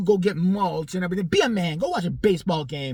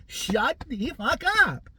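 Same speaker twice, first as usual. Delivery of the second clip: normal talking voice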